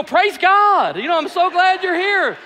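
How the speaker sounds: loud, high, overjoyed mock welcome; put-on pastor greeting. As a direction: gush a fake church greeting. Fake pastor greeting to tailgated driver.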